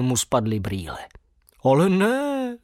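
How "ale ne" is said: high, startled cry; a light surprised gasp of recognition; surprised exclamation. A narrator's reading.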